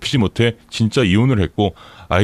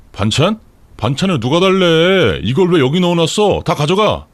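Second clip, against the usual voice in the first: higher, acted angry outburst; angry husband; 7 semitones higher, 3 dB louder than usual. A narrator's reading. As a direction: lash out at the unwanted food. Husband throws side dishes in anger.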